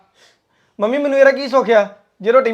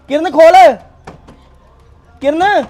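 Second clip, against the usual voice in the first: loud, higher yell of her name; angry shout; 4 semitones higher, 6 dB louder, slower than usual. Acted. Husband locked out yells for Kiran, loud shout. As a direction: bellow it through the locked door. Locked out, calling through door.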